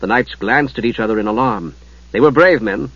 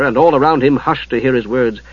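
usual speaking voice

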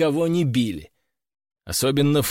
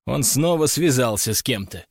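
usual speaking voice

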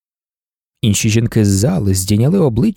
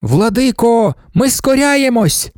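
high, drawn-out devotional cry; unanimous response; 8 semitones higher, slower than usual. A narrator's reading. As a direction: answer the master as a whole crowd, pitched way up. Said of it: "Вони одноголосно відповіли".

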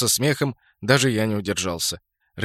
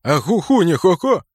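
indignant retort; 7 semitones higher, 6 dB louder than usual. Acted.

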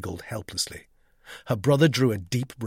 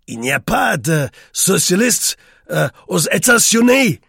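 put-on loud, high girl struggling in French; hesitant American girl's schoolroom French; girl's poor French; 6 semitones higher, 10 dB louder than usual. A narrator's reading. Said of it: "was little better than her brother's".

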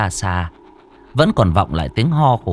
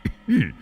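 put-on old man cough; 9 semitones higher than usual. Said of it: "khủ khụ vang lên".

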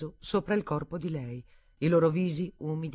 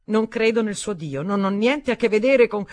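higher, louder, heated; angry retort; 6 semitones higher, 10 dB louder than usual. Acted. Stephanie rejecting Daniel's God, heated and loud. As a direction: fling it back at him.

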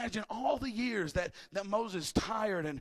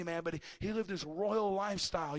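normal talking voice

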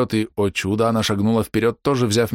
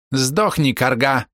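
angry shout; 6 semitones higher, much slower than usual. Acted. Demon girl attacks old woman.

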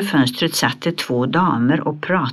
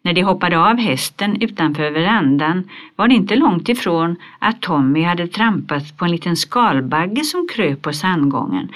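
usual speaking voice

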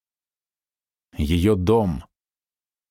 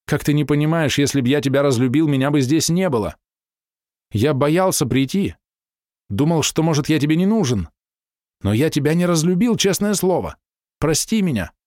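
higher, pleading man's voice; begging her, voice raised high and urgent; pleading lover; 6 semitones higher than usual. A narrator's reading. Hero pleads with weeping lover. (умоляющий, взволнованный, заметно выше)

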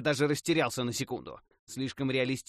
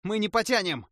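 yelling; 10 semitones higher, 6 dB louder than usual. A narrator's reading. Elf keeps yelling for help.

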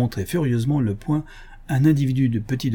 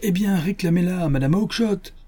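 higher, acted brush-off; irritated retort; 9 semitones higher than usual. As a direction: snappish dealer, mildly acted. Dealer's acted quarrel with little man.

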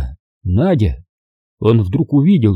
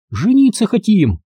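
put-on high timid squeak of a girl; high, shy girl's squeak; 9 semitones higher than usual. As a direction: squeak it out from behind his back. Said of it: "пискнула из-за спины".

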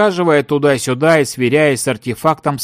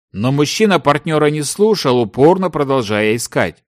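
ordinary delivery, nothing marked

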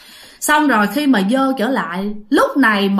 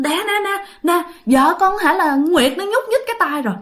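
excited calling out; 7 semitones higher than usual. Retold or imitated. Husband calls to family as wife's hand moves, high excited call. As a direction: call out in a mild mock shout, pitch raised. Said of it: "cậu mới la lên".

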